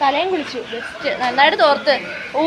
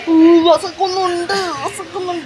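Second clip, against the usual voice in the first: mock whine; 5 semitones higher than usual. Waiting hungry for food.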